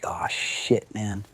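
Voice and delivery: whispered, soft dread; scared whisper. Retold or imitated. Hearing cops at the door, a hushed scared whisper. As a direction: breathe it out in panic. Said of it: "I was like".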